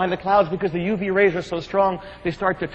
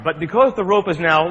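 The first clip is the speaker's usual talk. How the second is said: usual speaking voice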